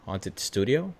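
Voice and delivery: soft, low, questioning; thinking aloud under the breath; tentative title suggestion. Real, not acted. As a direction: float the title quietly, rising at the end. Trying out a possible title.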